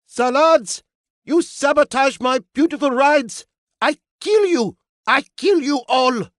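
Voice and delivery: put-on high, loud accented fury; furious maestro. Maestro raging at saboteurs, furious accent. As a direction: rage in a thick foreign accent.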